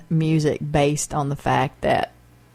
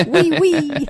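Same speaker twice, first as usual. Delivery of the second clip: high, loud squealed French; mock French accent; 10 semitones higher, 5 dB louder than usual. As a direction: chime in with silly, high-pitched French. Joining in the mock French joke.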